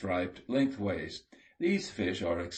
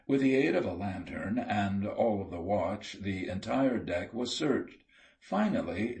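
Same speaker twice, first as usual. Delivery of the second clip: usual speaking voice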